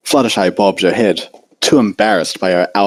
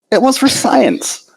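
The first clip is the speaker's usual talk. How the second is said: higher, slower disbelieving voice; incredulous Twilight. A narrator's reading. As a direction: Twilight doubting Fluttershy's contest, incredulous and slow. Twilight defending her research.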